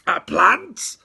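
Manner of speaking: shrieking high and loud; horrified yell. A narrator's reading. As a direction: scream it in outrage. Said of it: "yelled Mum in horror".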